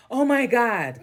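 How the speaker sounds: hammed-up shocked boyfriend; mocking a man falling apart; mock riled-up ex. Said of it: "They expect you to go riled up and".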